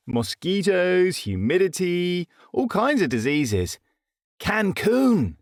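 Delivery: high, thrilled announcement; excited. A narrator's reading. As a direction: sell the dream of Cancun. Jackson voiced, excited.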